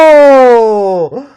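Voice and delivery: long, loud, high falling "ohhh"; loud outraged "oh". Real, not acted. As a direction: howl at a fighting taunt. Reacts to friend's taunt.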